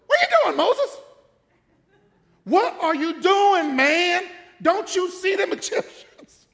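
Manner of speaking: high, loud, laughing voice of God; God, amused and impatient. Retold or imitated. God scolding Moses at the sea, high and loud. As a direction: tease Moses like an exasperated boss. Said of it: "And the Lord goes".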